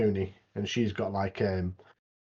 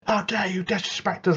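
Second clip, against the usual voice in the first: mock shout; 8 semitones higher than usual. Big guy scolding the rivals, mild mock shout. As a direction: imitate the big guy's outrage, half-hearted. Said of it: "He's, like, shouting".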